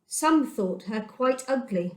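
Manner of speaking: usual speaking voice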